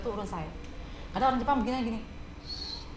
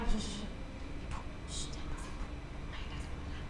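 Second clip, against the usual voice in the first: whispered hush to noisy ladies; whispered shushing; 13 dB quieter than usual. Retold or imitated. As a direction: hush the tour group urgently. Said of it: "Saya gitu begitu ya".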